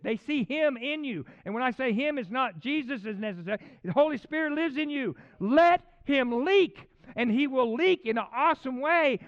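loud, high, urgent sermon delivery; full-force preaching. Real, not acted. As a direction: preach it hard, punching each phrase.

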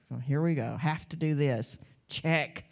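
low-pitched, grudging mumble of duty; mock resignation, pitch dropped; resigned obligation. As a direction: imitate a reluctant shopper ticking off a chore. Imitates reluctant Valentine's card shoppers.

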